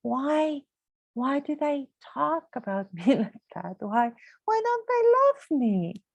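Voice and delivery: high, pleading self-questioning, mildly hammed; anxious inner voice. Woman mimicking her insecure inner voice, plaintive and pleading. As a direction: imitate her own worried thoughts, rising and plaintive.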